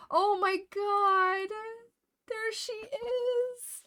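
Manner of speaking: high, slow, drawn-out squeal of joy; delighted squeal. Real, not acted. Streamer finds her lost pony picture, squealing with joy. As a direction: gush over a long-lost favorite toy.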